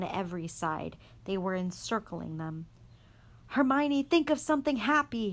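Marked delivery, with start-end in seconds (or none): 3.5-5.3 high loud shout; yelled. A narrator's reading. Harry shouting to Hermione as Dementors close, yelling. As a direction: yell over the screaming. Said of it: "Harry yelled".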